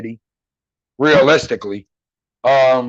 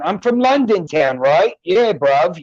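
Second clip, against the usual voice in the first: street London British accent; lilting London slang, starting higher; 3 semitones higher, slower than usual. Shown as a demonstration. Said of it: "if you start breaking it down".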